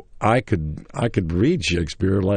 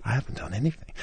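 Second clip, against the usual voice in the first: soft, higher-pitched confession; hushed reply; 5 semitones higher, 7 dB quieter, faster than usual. Retold or imitated. Young actor privately answering the man on the phone, hushed. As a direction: answer under your breath, much softer.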